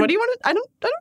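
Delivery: high-pitched bewildered squeak; mock outraged. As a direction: squeal in fake confusion. Mock offended friend.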